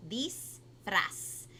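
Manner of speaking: Disfraz with the r rolled on the tongue. Shown as a demonstration. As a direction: roll the r in Disfraz. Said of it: "you have to roll the R with the tongue".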